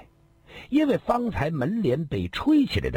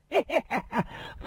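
high cackle; 12 semitones higher, 4 dB quieter than usual. Acted. Laugh inside boast.

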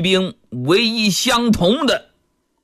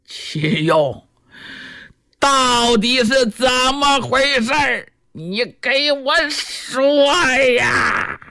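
悲愤逼问; 3 semitones higher, slower than usual. A narrator's reading. 罗成抓住秦用逼问.

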